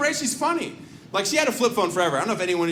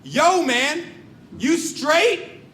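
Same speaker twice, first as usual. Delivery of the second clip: street guy calling out; 8 semitones higher, much slower than usual. Retold or imitated. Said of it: "He goes".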